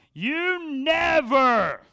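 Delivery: long drawn-out, high accusing yell; exaggerated accusing shout. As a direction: bellow it slowly as an over-the-top accusation. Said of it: "why I used that voice".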